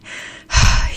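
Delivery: loud breathy pant. A narrator's reading. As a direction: heave a breath after a fright.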